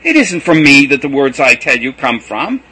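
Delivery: loud high exasperated pleading throughout; impassioned cry. A narrator's reading. Jesus pleading with disciples, loud, high and impassioned. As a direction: press every line with passion. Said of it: "cried Jesus".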